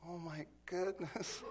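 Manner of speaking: acted-out hushed oh my goodness; stunned dismay. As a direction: draw it out as the truth sinks in. Mike realises he is caught.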